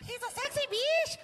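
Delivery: high-pitched imitation of a wife; wife's voice. Comic quoting his wife's pet name for him, high squeal. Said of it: "His wife calls him that".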